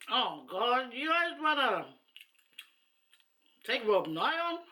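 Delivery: high-pitched, incredulous challenge; mock disbelief. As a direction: react in amused disbelief, pitch lifted high. Acts out amazed thought at challengers.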